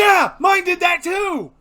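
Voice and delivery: very high loud shout; excited shout. Real, not acted. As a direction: yell it in excitement.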